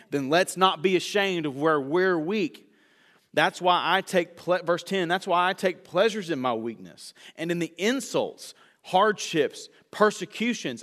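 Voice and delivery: full-force pulpit delivery; building sermon. Real, not acted. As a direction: preach it out with rising fire.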